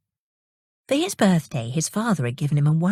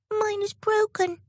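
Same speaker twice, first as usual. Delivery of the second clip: put-on very high, soft little baby voice; a tiny fairy upset at her broken balloon; baby fairy crying out; 4 dB quieter than usual. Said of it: "cried a baby tumbling down".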